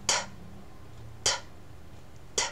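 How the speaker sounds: T as a bare tongue tap of air, no voice; isolated unvoiced T clicks, spaced apart; voiceless consonant. Shown as a demonstration. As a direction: release T with breath only. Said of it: "就是清辅音".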